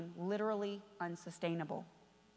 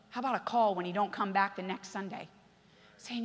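ordinary talk

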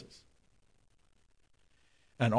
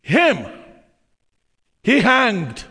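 preaching shout; 7 semitones higher, 6 dB louder, slower than usual. Real, not acted. Preacher climaxes the verse.

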